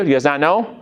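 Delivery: normal talking voice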